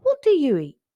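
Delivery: high, loud eager ask; curious question. A narrator's reading. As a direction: pop the question with wide-eyed interest. Curious cub questions the boar.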